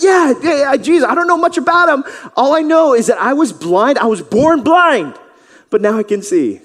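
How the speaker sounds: high, loud, eager reply; eager excitement. Acted. Healed man answering the Pharisees, high and eager. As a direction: gush at the Pharisees. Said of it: "he's like".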